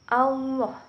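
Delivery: "Allah" with a thick, rounded "au" sound, like "Auloh"; teacher shows the thickened lam in "Allah"; heavy lam. Shown as a demonstration. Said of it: "akan tetapi au".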